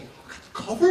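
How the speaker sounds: high rising squeak; can't believe it; shocked question at the offer; stunned disbelief. Retold or imitated. Disbelief at getting cover.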